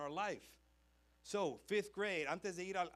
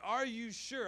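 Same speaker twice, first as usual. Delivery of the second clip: mock doubting teacher; 7 semitones higher, 6 dB louder, much slower than usual. Teacher pressing the boy.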